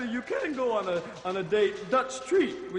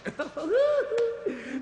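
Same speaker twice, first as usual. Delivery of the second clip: high cackle; 12 semitones higher than usual. Acted.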